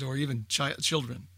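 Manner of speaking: normal talking voice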